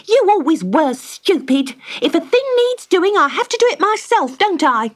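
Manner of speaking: high, loud, furious nagging wife; angry scolding. Acted. Wife Magda furiously scolding Cedric for his foolishness. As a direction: berate him at full volume.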